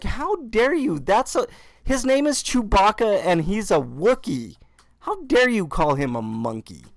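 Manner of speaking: high, hammed-up indignant scolding; mock outrage. Son scolding mom for calling Chewbacca a monkey, mock outraged. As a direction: defend Chewbacca in mock fury. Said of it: "these were my exact words".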